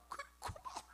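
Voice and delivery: very high, soft mock-tearful voice; grateful wife. Wife moved by husband's kind word, hammed-up tearful gratitude. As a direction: squeak it out, overcome with thanks. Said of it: "그 말 한마디만 해도 여자가".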